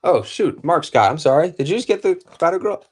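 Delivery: high, rushed apology; sudden startled realization, high; flustered surprise. Real, not acted. Realizing missed auction end.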